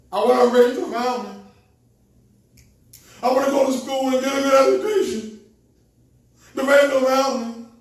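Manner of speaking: loud, high, fast eager recital; mock eager voice. Mimicking others chasing family and cars, loud and eager. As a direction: rattle off the dreams in a put-on voice. Quoting others' talk of dreams.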